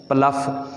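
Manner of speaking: प्लफ ending in an f, "pluff"; wrong pronunciation. Shown as a demonstration. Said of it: "mispronounce this word as". (गलत तलफ़्फ़ुज़, प्लफ के आख़िर में "फ़")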